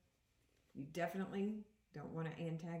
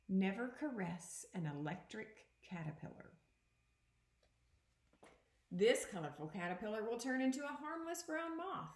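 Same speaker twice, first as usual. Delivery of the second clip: a clear, even read-aloud voice for the class; teacher reading a picture book aloud; reading aloud to children; 2 semitones higher than usual. Acted. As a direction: read the book to the kids, a touch dramatic. Teacher reads animal book aloud.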